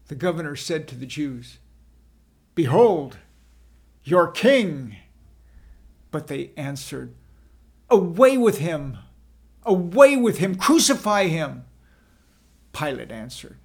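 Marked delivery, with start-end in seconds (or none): 2.6-5.0 loud presentation; proclaiming. A narrator's reading. Pilate presents Jesus to crowd. 7.9-11.7 loud demanding shout; mob shouting. A narrator's reading. Crowd demands crucifixion.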